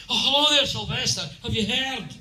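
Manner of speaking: high, loud mock-Scottish newspaper caller; Scottish reporter. Scots papers ring Sylvester about David's accent, mock-Scottish outrage. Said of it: "Scots papers rang me up and said".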